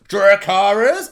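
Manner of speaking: a bolder second try; firmer command. Retold or imitated. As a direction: push it harder the second time. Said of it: "she's like".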